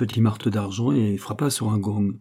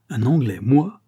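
loud, offended exclamation, voice lifted; a sailor stung by an insult; indignant outcry; 3 semitones higher, 5 dB louder than usual. A narrator's reading. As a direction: throw the word back, affronted. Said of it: "s'écria le capitaine avec indignation".

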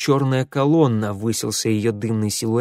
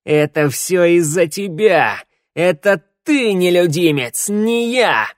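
loud, higher, slow furious snarl; growling accusation; 6 semitones higher, 7 dB louder, slower than usual. A narrator's reading. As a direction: blame him with hatred. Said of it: "прорычал Ворона".

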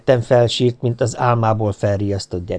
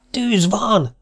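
shout of alarm; 11 semitones higher than usual. A narrator's reading. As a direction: yell it out in panic. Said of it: "kiáltotta egy ilyet hang". (rémült kiáltás)

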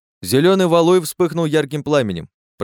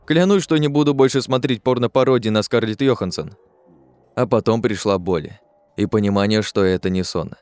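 ordinary delivery, nothing marked

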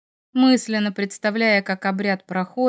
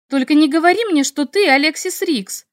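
higher and louder, half laughing disbelief; incredulous outcry; 6 semitones higher, 5 dB louder than usual. A narrator's reading. Heroine realizes her friend is her favorite author, incredulous. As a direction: blurt it out in shock.

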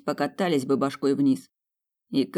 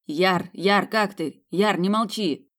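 worried calling; 5 semitones higher, slower than usual. Acted. Brother calling out to fallen man.

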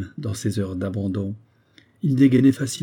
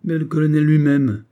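high, loud burst of impatience; mildly acted exasperation, pitched up; impatient; 6 semitones higher, 6 dB louder than usual. A narrator's reading. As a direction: flare up impatiently. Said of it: "impatienté".

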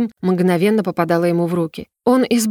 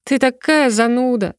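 higher-pitched, teasing complaint; snorting annoyance; 5 semitones higher than usual. A narrator's reading. Girl answering her friend's lecture, snorting annoyance. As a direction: scoff at a tiresome friend. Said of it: "фыркнула я".

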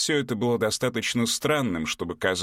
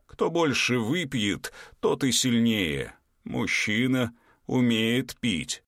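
slow, solemn giant voice; slow ancient giant; slower than usual. Acted. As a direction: speak like an old field spirit. Giant Pekko speaks.

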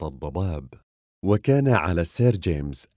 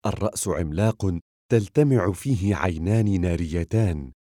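ordinary delivery

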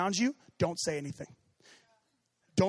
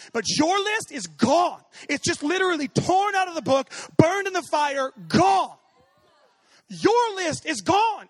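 loud, high, slow shouted proclamation; preaching at full force; 8 semitones higher, 7 dB louder, slower than usual. Real, not acted. Preacher proclaiming to congregation that sins are gone, shouted. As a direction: build to a roaring declaration.

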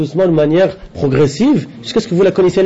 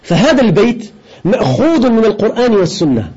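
raised, measured reading voice; Arabic commentary reading; 4 semitones higher, faster than usual. Acted. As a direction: read the scholar's text out to the class. (lecture à voix haute, ton élevé, du commentaire arabe)

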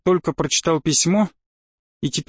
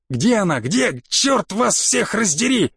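acted fury building to a drawn-out curse; contained yell with stretched final oath; enraged yelling; 5 semitones higher, slower than usual. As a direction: demand it, then curse everyone, pitch rising. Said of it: "заорал".

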